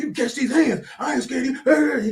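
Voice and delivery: high, loud mock threat; angry patient threatening the nurse, loud and high. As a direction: talk big like you're ready to fight. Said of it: "He was like".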